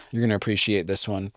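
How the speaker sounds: usual speaking voice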